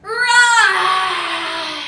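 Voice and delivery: long playful lion roar. Shown as a demonstration. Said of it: "give me a loud roar".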